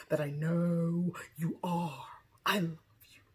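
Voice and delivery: tender whisper of love. Retold or imitated. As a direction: breathe it out in a hush. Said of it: "she was like".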